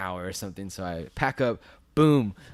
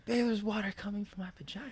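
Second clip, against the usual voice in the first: thin, high-pitched mock yell held back in volume; mock background yell; 12 semitones higher, 4 dB quieter than usual. Wife yelling in the background of a phone call, mock high yell. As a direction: imitate a partner yelling from afar, high. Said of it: "she yells it in the background".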